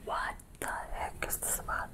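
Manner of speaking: hushed, voice drained by crying; asking herself through tears; tearful whisper. Real, not acted. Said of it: "first time I cried".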